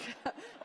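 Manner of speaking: quick high laugh slipping out; amused giggle before the punchline; short laugh. Real, not acted. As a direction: let a little laugh escape. Laughing while telling the story.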